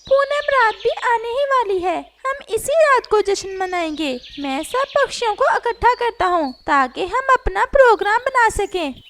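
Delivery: a lively parrot talking to his friend. Acted. Parrot plans the party.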